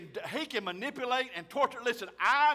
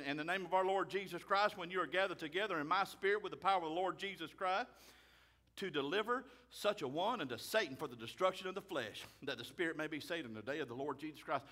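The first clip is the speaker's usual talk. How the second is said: scripture reading; 3 semitones lower, 5 dB quieter than usual. Acted. Reads scripture aloud.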